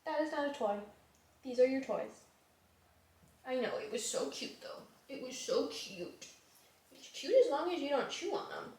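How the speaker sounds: soft, gentle pet talk. Real, not acted. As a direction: coo softly at the dog. Scolding a pet about chewing.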